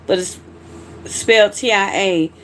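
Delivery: normal talking voice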